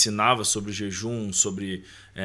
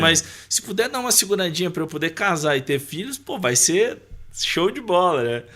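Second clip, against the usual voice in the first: pitched-up bargaining with God, half joking; cheeky child's prayer; 5 semitones higher, 5 dB louder than usual. Retold or imitated. Boy asking Jesus to hold off his return, playfully bargaining. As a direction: plead playfully for a little delay. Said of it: "eu orava ao Senhor dizendo".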